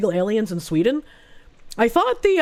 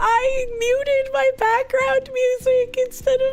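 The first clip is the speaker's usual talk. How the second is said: much higher, slow, whimpering apology; embarrassed whimper; 14 semitones higher, much slower than usual. Real, not acted. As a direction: whine the apology, mortified. Mic mishap, embarrassed apology.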